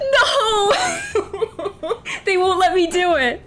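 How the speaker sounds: cracking up in mock outrage; laughing. Real, not acted. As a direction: laugh through the disbelief. Game blocks her attack choice.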